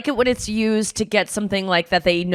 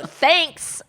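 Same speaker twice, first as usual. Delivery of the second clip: pointed thanks; 8 semitones higher than usual. Retold or imitated. Curt thanks to the clerk.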